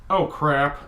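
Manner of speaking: ordinary talk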